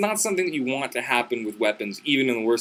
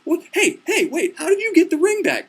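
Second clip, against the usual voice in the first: high, louder squealed demand; mock outrage; 4 dB louder than usual. Bride spotting the ring on him, squealing mock indignation. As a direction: fire off squeaky, indignant questions. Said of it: "And she gets real mad".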